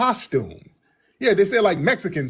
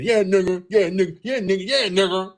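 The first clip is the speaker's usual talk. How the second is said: mock repetition. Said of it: "Listen to them and how unnatural".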